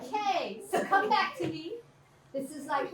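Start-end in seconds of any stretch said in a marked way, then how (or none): 0.0-1.7 higher and louder, pulling everyone's attention back; calling the class back. Real, not acted. Teacher gathering students after the exercise, raised call. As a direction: call them back to you.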